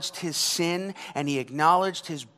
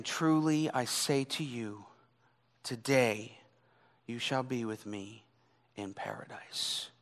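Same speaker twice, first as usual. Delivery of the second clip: hushed promise; 5 semitones lower, 7 dB quieter than usual. Acted. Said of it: "spoke out saying".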